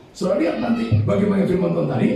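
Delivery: ordinary talk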